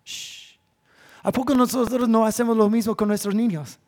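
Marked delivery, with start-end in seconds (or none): none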